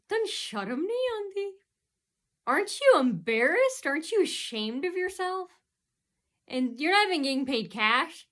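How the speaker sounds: ordinary talk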